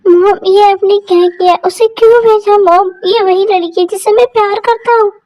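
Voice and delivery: upset son begging for answers; tearful pleading. Acted. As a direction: plead with mom as if close to tears. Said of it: "ये Jungkook जीजू क्यों रो रहे हैं".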